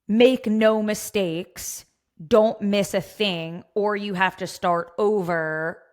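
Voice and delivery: slow, emphatic. Acted. Mocking strict challenge rules.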